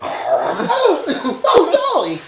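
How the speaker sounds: high laughing "oh golly"; laughing squeal. Real, not acted. Laughing at his own fear.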